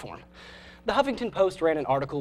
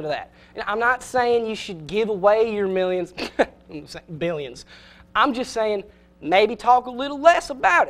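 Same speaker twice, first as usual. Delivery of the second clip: hammed-up Southern coach. Host as Southern coach lecturing Republicans, mock Southern accent. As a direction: play a Southern coach riling up the team. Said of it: "I coach and I hack Southern accent".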